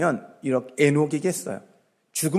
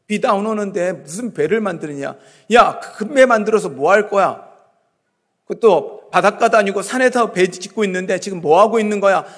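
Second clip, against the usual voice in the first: imitated jeering, raised voice; mocking; 5 semitones higher, 7 dB louder than usual. Onlookers jeering at Noah building his boat, acted mocking. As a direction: call out like a scoffing onlooker. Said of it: "얼마나 많은 사람들이 비웃었을 거예요".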